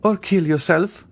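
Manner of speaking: high, cold finishing command; merciless. A narrator's reading. Eugénie telling Andrea to kill himself, merciless. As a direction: urge him to finish it. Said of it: "in a tone which a vestal in the amphitheatre".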